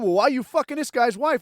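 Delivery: yelling boss. Retold or imitated. Boss confronts a worker about an affair, mock yelling. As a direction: imitate a boss chewing someone out. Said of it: "now the boss is yelling at him".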